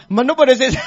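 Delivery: loud, high laugh breaking into the line; amused laugh. Real, not acted. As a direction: laugh it out mid-sentence. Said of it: "laugh heard".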